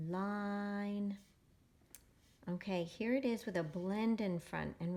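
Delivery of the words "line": "line" stretched slowly with a long held I; decoding demo. Shown as a demonstration. Teacher modeling reading for children, "line" drawn out smoothly. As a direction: sound out "line", sustaining the vowel evenly. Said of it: "going to read it like this".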